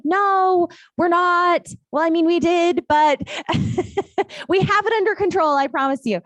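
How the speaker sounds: high, hurried defensive promise with a laugh caught inside; flustered reassurance. Retold or imitated. Agent answering the angry buyer, high flustered laughing reassurance. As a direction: backpedal nervously, then promise.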